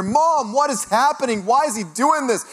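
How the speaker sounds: high, loud panicked wail to mom; retold scream. Freaked-out broker to his mom, retold screaming. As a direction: act out yelling for mom in a panic. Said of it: "I, I screamed".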